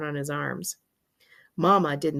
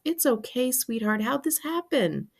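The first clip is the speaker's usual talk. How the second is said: high, tearful comforting; tender mother's wobbling concern; near tears; 7 semitones higher than usual. A narrator's reading. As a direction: comfort him as if about to cry. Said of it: "sounded like she was going to be crying".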